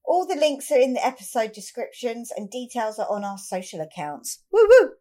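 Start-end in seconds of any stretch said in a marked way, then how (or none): 4.5-4.9 high, loud whoop; excited cheer. Real, not acted. Hyping the live show tickets.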